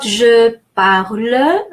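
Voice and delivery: je parle with "euh" endings; final French E. Shown as a demonstration. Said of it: "It's just the final E".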